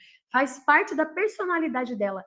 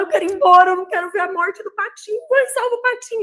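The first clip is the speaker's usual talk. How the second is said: higher and louder tearful plea; imitating crying child; 6 semitones higher, 5 dB louder, faster than usual. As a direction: mimic the desperate sobbing daughter. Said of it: "E chorando".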